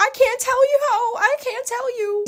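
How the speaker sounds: very high-pitched mimicked maid; mock maid voice. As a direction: squeak it high, imitating a coy refusal. Voicing the maid withholding a secret.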